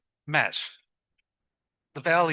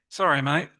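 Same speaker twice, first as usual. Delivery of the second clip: ordinary talk, nothing marked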